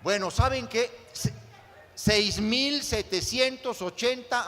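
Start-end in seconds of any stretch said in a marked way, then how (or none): none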